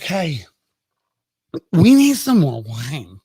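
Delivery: slow, slurred tipsy demand; drunk mom. Acted. Tipsy Mary asking her son for wine, slurred. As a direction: sound a bit drunk. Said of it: "she's like".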